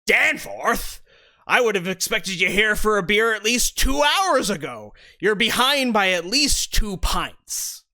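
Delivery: loud, jolly tavern keeper ribbing a late regular; Bart the barkeep. Acted. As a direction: rib the latecomer from behind the bar. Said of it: "Bart turns to Danforth ... and says".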